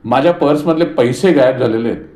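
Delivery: loud, high accusing cry; daughter-in-law loudly accusing the household. A narrator's reading. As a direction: shout that your money is gone. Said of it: "आवाज चढवून म्हणाली".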